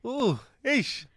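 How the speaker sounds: animated Sotho exclamations; Sotho accent. Shown as a demonstration. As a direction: show off a Sotho accent on request. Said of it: "Okay, do it for us".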